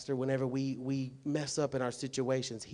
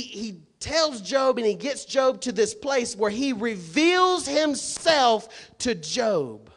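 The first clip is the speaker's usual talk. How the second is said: high, loud, driving sermon voice; preaching at full force; 8 semitones higher, 11 dB louder than usual. Acted. Pastor thundering at the congregation about Job, full-force preaching. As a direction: hammer it home from the pulpit.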